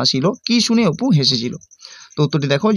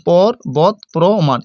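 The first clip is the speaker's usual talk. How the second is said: slow syllable split; much slower than usual. Shown as a demonstration. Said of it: "যদি বলি পর্বত প্রমাণ".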